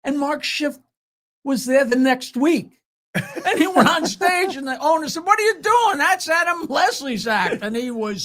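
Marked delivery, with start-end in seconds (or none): 5.2-7.6 imitating an indignant boss yelling; outraged. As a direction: call out a thief on stage. Club owner confronts comic on stage.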